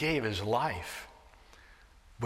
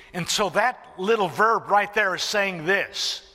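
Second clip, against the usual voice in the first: louder and higher, driving sermon voice; full-force preaching; 5 semitones higher, 6 dB louder than usual. Real, not acted. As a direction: preach it out with fire.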